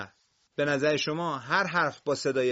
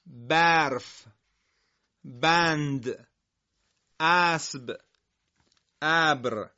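each word alone, louder, with long pauses; careful word demo; 5 dB louder, much slower than usual. Shown as a demonstration. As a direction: say every word clearly for the class to copy.

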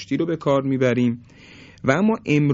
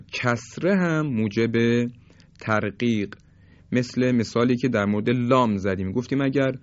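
normal talking voice

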